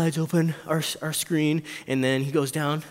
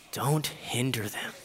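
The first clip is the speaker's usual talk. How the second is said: soft, slow and low; tender, unhurried plea; gentle instruction; 4 semitones lower, 6 dB quieter, slower than usual. Acted. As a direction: urge them quietly, taking time. Jesus gently welcoming the children.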